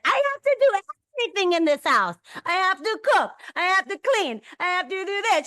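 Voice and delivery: high, hammed-up complaining mother; dramatic martyr mom. Mother on the phone to her friend, high hammed-up martyr complaint. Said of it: "and she's like".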